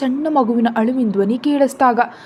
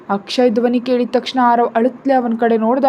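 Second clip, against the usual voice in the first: plain reading voice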